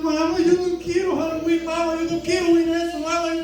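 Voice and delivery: put-on a frightened little kid begging his mother; terrified child. Frightened child begging his mom to skip Halloween, high pleading. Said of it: "Y el niño aterrado".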